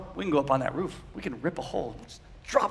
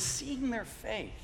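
soft reread; 4 semitones higher, 9 dB quieter, much slower than usual. Shown as a demonstration. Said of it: "He says".

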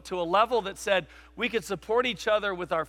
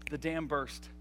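ordinary delivery

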